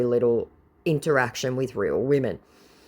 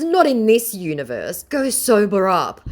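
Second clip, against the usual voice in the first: lightly voiced viewer; 7 semitones higher, 4 dB louder than usual. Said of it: "she goes".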